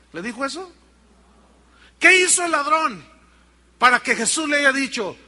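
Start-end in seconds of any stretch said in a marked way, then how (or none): 2.0-5.2 loud, high preacher's question; forceful rhetorical challenge. Real, not acted. Preacher asking church what the thief did, loud challenge. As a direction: throw the question hard at the congregation.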